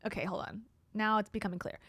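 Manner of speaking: ordinary talk, nothing marked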